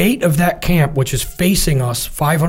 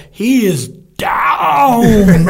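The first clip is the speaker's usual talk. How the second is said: very slow, much higher and louder, aghast; stunned report; 7 semitones higher, 4 dB louder, much slower than usual. Retold or imitated. Comms guy announcing the hit to the shooter, aghast. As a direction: drag each word out in shock.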